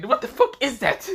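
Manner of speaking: high-pitched disbelief; mock incredulous outburst. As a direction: blurt it out high, as if amused. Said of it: "Sanji is bursting out laughing and saying".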